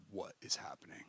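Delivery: awed, unvoiced whisper; stunned whisper. Telling the story of his friend's breakthrough, stunned whisper. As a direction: whisper it like you can't believe it.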